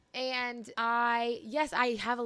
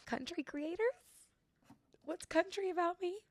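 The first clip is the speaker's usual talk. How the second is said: mock bewilderment; 8 semitones higher than usual. Her reply to management's label, mock-baffled high voice. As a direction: act baffled in a small high voice. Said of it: "And I was like".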